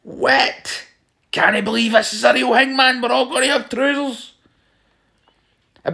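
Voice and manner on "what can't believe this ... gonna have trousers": high, astonished gasping disbelief; amazed villagers. Retold or imitated. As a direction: marvel at a miracle, voice climbing high. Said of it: "People would have been standing there going".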